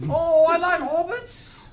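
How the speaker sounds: high, loud, slow put-on voice; Peter Jackson. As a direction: draw out a badly faked director impression. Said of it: "Do, uh, Peter Jackson".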